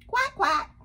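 high, loud double quack; duck voice. Acted. Duck's closing line in story.

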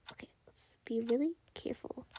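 hushed fast whisper; tense whispered plan. Real, not acted. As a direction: whisper so nobody hears. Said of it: "Sorry guys if I'm really quiet".

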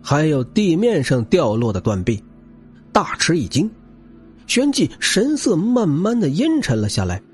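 plain reading voice

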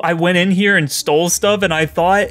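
ordinary talk, nothing marked

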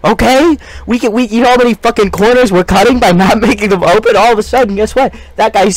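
high, pushy infomercial rant; hard sell against the rival; cheesy TV pitchman, amped up; hyped sales pitch. Pretend.

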